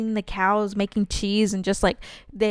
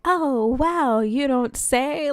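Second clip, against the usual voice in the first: drawn-out, higher-pitched fake amazement; sarcastic mock surprise; 5 semitones higher, much slower than usual. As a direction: act amazed at the painfully obvious. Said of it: "watch them just be like".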